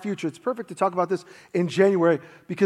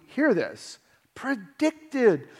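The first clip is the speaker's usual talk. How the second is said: ordinary delivery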